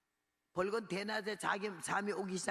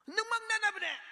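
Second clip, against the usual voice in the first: high, loud cry of discovery; amazed joyful shout; 12 semitones higher, 6 dB louder than usual. Retold or imitated. Patient finds breathing no longer hurts, amazed high shout. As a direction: shout it high, astonished at being healed.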